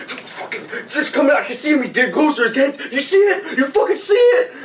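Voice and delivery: high, laughing, hammed-up alarm; mock excited soldier. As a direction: overeager jumpy private. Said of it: "just like".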